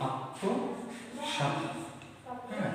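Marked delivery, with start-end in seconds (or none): 0.1-1.6 আত্মসাৎ whispered, very slow and high; whispered slow word. Shown as a demonstration. Teacher whispering the word slowly to pupils. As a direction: breathe আত্মসাৎ out at a crawl. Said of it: "কি আছে?".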